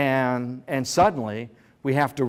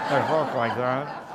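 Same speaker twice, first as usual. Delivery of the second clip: slow, mock-angry Episcopalian speech. Shown as a demonstration. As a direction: draw it out like an annoyed Episcopalian. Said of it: "when an Episcopalian is angry".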